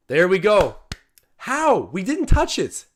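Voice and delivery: high, loud disbelief at the call; can't believe nobody touched it; incredulous outburst. Real, not acted. Reacting live to a bad call.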